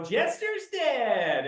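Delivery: high, very slow movie catchphrase; Top Gun line. Retold or imitated. Teacher answering the kid with a slow high movie line. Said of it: "And I go".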